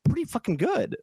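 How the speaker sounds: high-pitched amazed wow; retold, pleasantly surprised; impressed reaction. Actor retelling his reaction to co-star's accent, impressed. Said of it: "I'm like".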